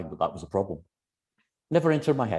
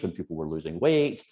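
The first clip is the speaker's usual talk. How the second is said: normal talking voice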